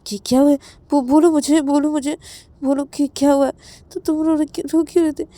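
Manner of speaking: high, loud stammering worry; frantic concern. Acted. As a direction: urgently press a friend for answers. Ravi frantically asks why Rahul cried.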